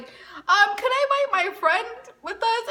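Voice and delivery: high, timid ask; nervous asking. Retold or imitated. Girl nervously asking her crush to bring a friend. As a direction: squeak out the request.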